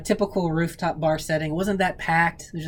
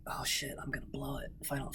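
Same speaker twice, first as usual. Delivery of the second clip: panicked whisper; 13 dB quieter than usual. Retold or imitated. Panicked inner thought retold.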